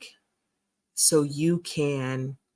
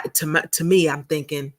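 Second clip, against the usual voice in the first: usual speaking voice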